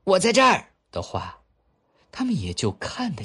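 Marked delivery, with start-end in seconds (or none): 0.0-0.7 much higher, loud call. Acted. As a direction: shout to be found. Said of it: "假如锡兵喊一声".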